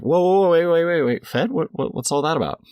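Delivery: loud, high alarmed double take; startled. Retold or imitated. The guy hears fed and stops them, startled and loud. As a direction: jump in with sudden alarm.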